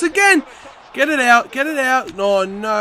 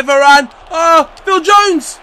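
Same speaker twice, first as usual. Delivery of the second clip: ordinary talk, nothing marked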